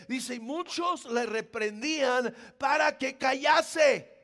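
high, full-force preaching shout; impassioned preaching. Real, not acted. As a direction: thunder it out to the congregation. Preacher insists he was shouting. (predicación apasionada a gritos)

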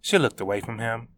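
ordinary delivery, nothing marked

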